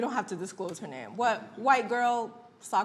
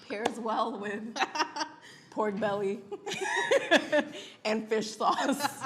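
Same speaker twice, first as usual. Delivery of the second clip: laughing; slower than usual. Real, not acted. Giggling through food pairing joke.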